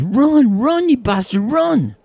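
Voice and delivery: an overexcited Scot in the bleachers; excited Scotsman yell. Acted. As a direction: bellow at the runner like a new fan. Scotsman yelling again.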